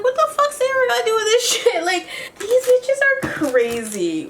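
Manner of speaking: squeaky high, laughing outrage; shocked outrage. Retold or imitated. Defending Sayora while recapping the fight, high and incredulous. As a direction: throw it way up in stunned disbelief. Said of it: "I was like".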